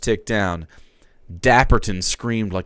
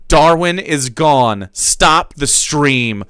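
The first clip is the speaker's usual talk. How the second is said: urgent; 5 semitones higher, 6 dB louder, much slower than usual. Acted. Urgent message read aloud.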